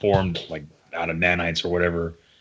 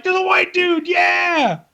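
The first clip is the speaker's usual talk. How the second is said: high shouted crowd cheer; hammed-up excited audience; mock cheering; 4 dB louder, much slower than usual. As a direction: yell it like a rowdy fan. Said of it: "They're like".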